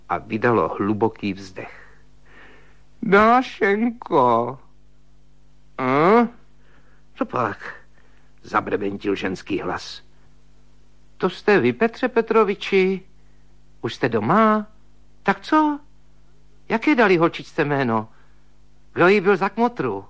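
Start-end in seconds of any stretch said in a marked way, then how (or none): 3.0-4.6 calling out. A narrator's reading. Calling her in dark bedroom. 5.8-20.0 higher, quick chattering spinster voice; old maid's chatter. A narrator's reading. Woken sister-in-law chatters at him in a high spinster voice.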